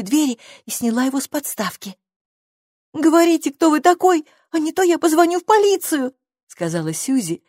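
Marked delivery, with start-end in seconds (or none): 2.9-6.1 high, louder threat holding back fear; nervous demand. A narrator's reading. Girl threatens a stranger with the police, nervous demand. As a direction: raise your voice to cover nerves. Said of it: "сдерживая дрожь в голосе".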